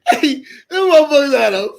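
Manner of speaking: real breathless laughter, squeaking high; collapsing with laughter; laughing. Real, not acted. Streamer telling chat about the letter, breathless laughing.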